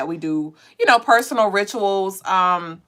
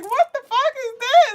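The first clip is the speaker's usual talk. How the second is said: baffled outburst. Retold or imitated. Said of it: "And I'm just like".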